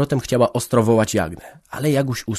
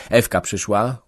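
ordinary delivery

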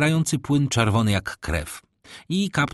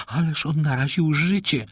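protesting lady; 6 semitones higher than usual. A narrator's reading. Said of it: "zawołała pani de Villefort". (protest damy, wysokim głosem)

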